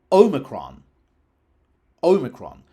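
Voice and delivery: Omicron with a long first vowel, OH-mi-kron; long initial O. Shown as a demonstration. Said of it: "lengthens the initial vowel of the word".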